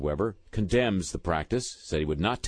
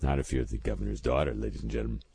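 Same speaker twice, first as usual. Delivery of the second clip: low, quieter wry punchline; dry aside; 9 semitones lower, 3 dB quieter, faster than usual. Real, not acted. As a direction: drop it low as a knowing aside. Dry aside after the quote.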